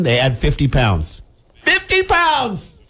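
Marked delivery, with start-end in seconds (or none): 1.6-2.8 high, drawn-out squeal of disbelief; hammed-up shock at the penalty; mock outraged cry. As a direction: wail it slowly, way up high. Acts outraged reaction to penalty.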